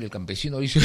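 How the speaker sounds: ordinary talk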